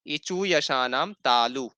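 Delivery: slow, careful teaching chant; slow recitation. Shown as a demonstration. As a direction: recite the sutra slowly, syllable by syllable. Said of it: "ताकि आप लोग अभ्यास भी कर लेंगे इसके उच्चारण का".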